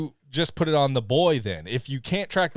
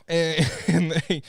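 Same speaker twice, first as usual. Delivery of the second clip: ordinary talk, nothing marked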